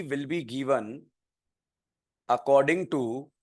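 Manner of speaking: very slow, low dictation with long pauses. Shown as a demonstration. Teacher dictating rule two to students, very slow. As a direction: drag each phrase out for students to write.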